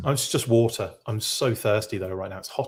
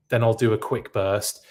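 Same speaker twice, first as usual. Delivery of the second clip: ordinary delivery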